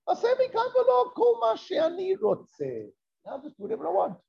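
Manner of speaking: put-on high, carefree teenage defiance; mock-reckless "I'll do what I like"; defiant son. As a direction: toss it off like a rebellious son who's free at last. Son's defiant inner thoughts.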